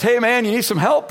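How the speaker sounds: tow truck guy. Retold or imitated. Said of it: "And the guy gets out and says".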